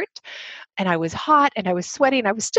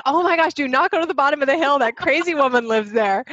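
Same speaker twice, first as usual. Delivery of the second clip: mock gossiping neighbors; 5 semitones higher than usual. Neighbors voiced warning children.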